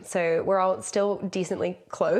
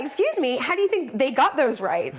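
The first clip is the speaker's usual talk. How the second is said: high, mock-indignant comeback; playfully retold outrage; indignant retort; 6 semitones higher, 3 dB louder than usual. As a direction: challenge him, pitched right up.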